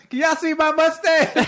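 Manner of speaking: high mock question. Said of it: "she goes".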